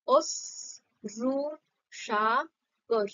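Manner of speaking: অশ্রু সাগর drawn out very slowly, each syllable held; slow dictation of অশ্রু সাগর. Shown as a demonstration. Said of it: "এটাকে দল আকারে ভাগ".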